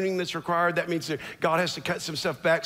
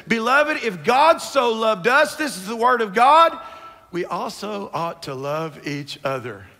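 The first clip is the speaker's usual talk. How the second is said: higher, louder scripture proclamation; impassioned preaching; 5 semitones higher, 6 dB louder than usual. Real, not acted. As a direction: preach the verse out with force.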